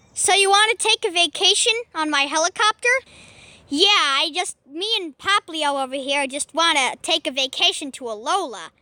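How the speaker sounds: ordinary delivery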